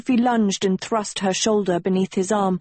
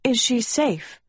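higher croaky question; anxious, croaked higher voice; weak croak; 5 semitones higher than usual. A narrator's reading. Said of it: "Agatha croaked".

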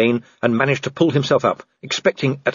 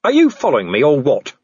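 raised, loud, testy question; irritated challenge; 4 semitones higher, 5 dB louder than usual. Acted. Vimes challenging Death on the branch, testy. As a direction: demand it of an unwelcome visitor.

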